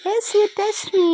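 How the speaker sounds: drawn-out, very slow teasing sing-song; slow playful baby talk. Pretend. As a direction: tease the little one, stretching every word.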